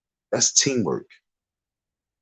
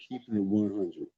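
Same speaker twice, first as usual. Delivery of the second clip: soft plain repeat; 9 dB quieter than usual. Shown as a demonstration. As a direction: drop back to a quiet sincere line. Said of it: "being sincere".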